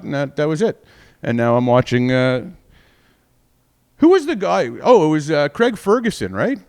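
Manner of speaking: normal talking voice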